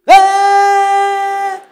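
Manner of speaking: piercing high yell; a sudden shriek from the labor bed; sudden scream. Retold or imitated. Wife in labor suddenly screams at husband, piercing and loud. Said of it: "suddenly she screams".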